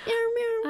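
squeaky imitated meow; high meow imitation. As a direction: mew like a little cat.

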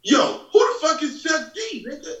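very high, brash imitation of a nephew; mock loud nephew. Nephew to uncle in front of the star, mock loud. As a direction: blurt it out like an oblivious loudmouth. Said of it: "as loud as he can go".

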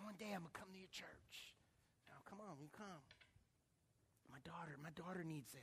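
very soft, near-whispered retelling; hushed confiding. Retold or imitated. Discouraged waitress tells pastor she will come, hushed.

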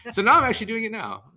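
loud, high mock boast; mock shout. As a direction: shout the boast, half joking. Joking claim shouted.

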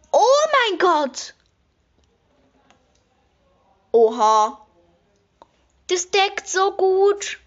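high, slow, loud gushing over the colour; gushing squeal. Real, not acted. To her viewers about the palette, high excited squeal. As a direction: squeal it out, stretching every word.

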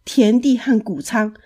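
ordinary delivery, nothing marked

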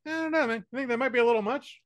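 doubtful movie character. Retold or imitated. Co-host voicing characters who drop the accent, high and quick. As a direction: rush it out like someone backing off the accent. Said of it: "and then they're like".

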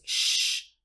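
sh, a long unvoiced hiss; sh sound. Shown as a demonstration. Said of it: "H en inglés".

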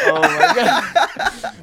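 high, slow, helpless laugh. Real, not acted. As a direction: let the laugh swallow it. Cracking up at the story.

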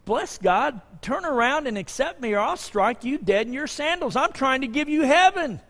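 mock forceful demand. What Jesus didn't say.

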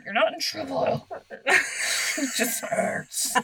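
breathy laughing reassurance; half-laughing mom voice, slow; laughing through it. Retold or imitated. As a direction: say it while cracking up. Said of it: "And I'm like".

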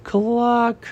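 high, drawn-out cluck; put-on high chicken voice. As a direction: voice a hen with one high cluck. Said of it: "Chicken thinks".

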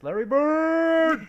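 drawn-out high shout; cheering. Real, not acted. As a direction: call the name like a fan. Sports fan cheering a name.

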